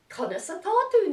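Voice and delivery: a tiny excited puppet shouting hello; puppet character voice. Acted. Puppet greeting the children in Irish, high and squeaky. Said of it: "two new characters".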